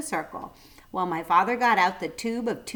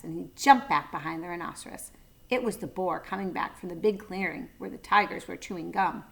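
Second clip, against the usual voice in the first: usual speaking voice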